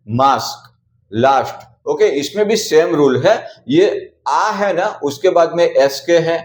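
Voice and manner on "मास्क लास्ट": मास्क and लास्ट said with a long "aa" vowel; aa sound demo. Shown as a demonstration. A teacher showing the ST and SK rule with a long "aa". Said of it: "आ पढ़ते हैं".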